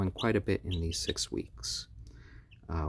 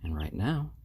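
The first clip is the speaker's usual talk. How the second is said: ordinary delivery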